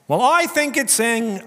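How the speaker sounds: sing-song mock opinion. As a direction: parody the smug interpreter. Mocking a reader.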